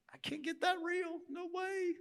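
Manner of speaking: shy away from confessing; a touch of nervous dodging; reluctant churchgoer. Pretend.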